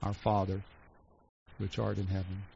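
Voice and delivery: softly recited prayer; quiet prayer. Retold or imitated. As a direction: murmur the prayer quietly, reverently. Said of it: "repeating with him our simple daily prayer".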